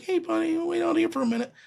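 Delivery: high, soft, kind voice to a friend; gentle. Retold or imitated. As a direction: ease him out of the room softly. Gently sending his client away.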